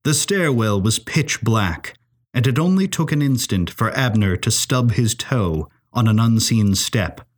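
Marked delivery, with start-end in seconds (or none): none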